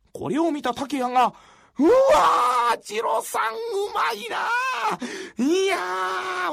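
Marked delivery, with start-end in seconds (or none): 1.8-6.5 high, slow, drawn-out cry of wonder; amazed shout. Acted. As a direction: gasp out loud in delight. Old man amazed by calligraphy.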